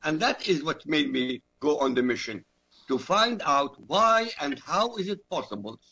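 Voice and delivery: ordinary talk, nothing marked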